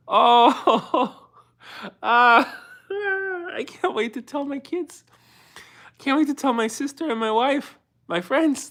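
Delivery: happy sobbing, barely holding it together; real tears of pride, words stretched out; crying with joy. Real, not acted. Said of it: "I'm getting emotional".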